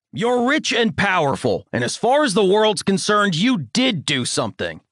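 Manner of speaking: high, scornful lecturing voice; ranting father. A narrator's reading. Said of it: "bombastic tirade".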